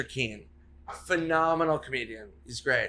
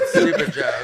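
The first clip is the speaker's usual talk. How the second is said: laughing; 7 dB louder than usual. Real, not acted. Laughing at own botched joke.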